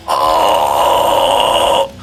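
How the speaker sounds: strained pinched throat scream; squeezed larynx scream. Shown as a demonstration. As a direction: squeeze too much, harsh. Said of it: "squeezing too much in your larynx".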